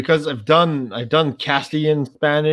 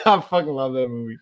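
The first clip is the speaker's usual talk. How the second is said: ordinary talk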